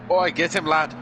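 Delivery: hammed-up Scottish shout of encouragement; terrible Scottish accent. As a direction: put on a bad Scottish accent and call it out. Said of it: "That's my terrible accent of Scottish".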